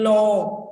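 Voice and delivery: long, held vowel in LONG; expanded word. Shown as a demonstration. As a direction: stretch "long" on a level tone. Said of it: "So expanding or compressing the word".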